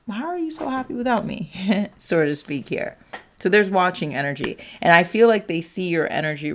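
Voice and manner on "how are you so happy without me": high-pitched, plaintive mock question; mock hurt wondering. Watcher wondering at the other's happiness, mock hurt. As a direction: lightly act out a wounded ex, pitched up.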